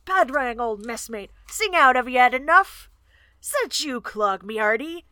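loud call across the water, rising on "sing out"; hailing from shore. Acted. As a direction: shout it up to the fortress walls. S0 reads Clog hailing from shore.

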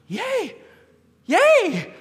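high, loud joyful shriek; imitated cheering son. Son told babies go to heaven, shrieking joy. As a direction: cheer like an overjoyed small boy. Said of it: "he starts going".

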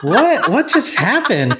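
high, laughing disbelief; surprised. Retold or imitated. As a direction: blurt it out, amazed and laughing. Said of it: "And I was like".

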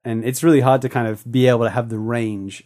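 ordinary talk

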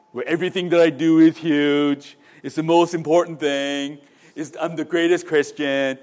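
boastful politician, loud and level in pitch; puffed-up bragging impression, pushed loud; Donald Trump. Preacher mimicking a prideful figure in a sermon, Donald Trump. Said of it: "That's my Donald Trump impression".

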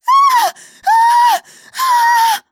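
shrill terrified screams, full volume; screaming woman. Acted. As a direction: scream in horror. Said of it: "she was screaming".

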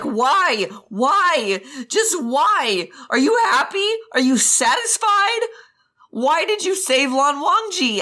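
high-pitched, anguished demands; berserk anger. Acted. Jiang Cheng shaking Wei Wuxian by the collar, berserk anger. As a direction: hammer out each question in rising fury. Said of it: "he's in absolute berserker mode".

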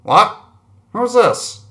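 high, loud startled voice; startled enemy. Acted. Enemy on the comms answering, high and startled. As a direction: jump up in pitch like caught off guard.

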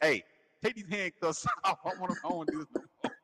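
high, giggly attempt at being serious; trying not to laugh. Handcuffed and tickled, trying to be serious through laughter. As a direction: protest through held-back laughter. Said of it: "try not to laugh".

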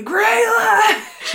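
high, loud drawn-out call; yelling. Acted. Cypress yelling periodically for a kobold, loud high yell. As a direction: yell the name down the halls. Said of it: "Cypress yells for the fifth time".

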